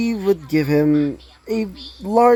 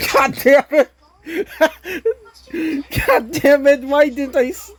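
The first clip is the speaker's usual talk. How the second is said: laughing at himself; 10 semitones higher than usual. Real, not acted. Narrator laughs at his own flub, swearing through real laughter. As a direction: crack up and swear through the giggles.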